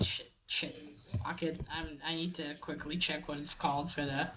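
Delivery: low, soft muttering to oneself; real frustrated aside, hushed; muttered frustration. Real, not acted. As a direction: curse quietly under your breath while searching. Muttering while searching for a word.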